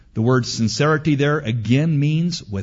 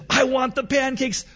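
little daughter; 13 semitones higher than usual. Retold or imitated. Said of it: "she said".

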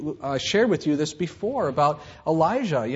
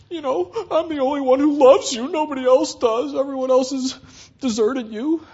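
high, loud self-pitying complaint; whiny Elijah; 9 semitones higher, 5 dB louder than usual. Retold or imitated. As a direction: whine like the lonely prophet. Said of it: "And he's like".

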